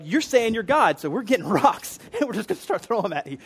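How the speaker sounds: preacher mimicking outraged Pharisees grabbing rocks, laughing; mock Pharisees. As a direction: play the Pharisees as a joke, with a laugh. Acted angry Pharisees, mocking retelling.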